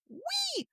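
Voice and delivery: a sudden high, playful whee; high squealed whee. Pretend. Host mimicking the hero flying back, squealed whee. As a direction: squeal it like a kid on a slide.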